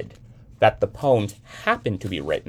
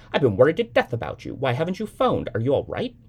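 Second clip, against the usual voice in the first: fast, higher, fretting mother; worried mother; 6 semitones higher, 4 dB louder, faster than usual. A narrator's reading. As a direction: rush it out like a panicked mother. Mother frets over the phone.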